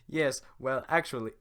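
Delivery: Shrek voice. Acted. Shrek starts talking to the villagers, in a hammed-up ogre voice. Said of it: "nail the Shrek voice at first".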